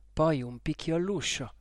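ordinary delivery

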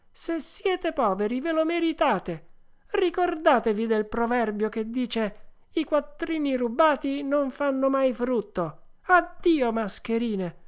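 high, emphatic puppet voice; scornful Pinocchio. Acted. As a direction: preach a proverb at swindlers and dismiss them. (burattino sprezzante, voce acuta e sentenziosa)

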